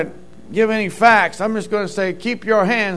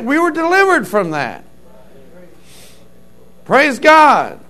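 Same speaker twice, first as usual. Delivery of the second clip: preaching; 3 semitones higher, 4 dB louder than usual. Acted. Pastor preaching his youth days to the congregation, rising fervor.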